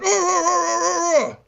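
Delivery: high, hammed-up cries of distress; mock pained screams. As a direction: wail like a guy being roughed up, played for laughs. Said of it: "screaming like a, like an abused Chinese guy".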